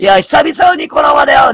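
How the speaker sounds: "久々" called out high and loud; excited shout. Real, not acted. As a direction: shout the greeting to the whole stream. Greets viewers on his stream. (高い声で叫ぶように「久々のニコ生だよ」)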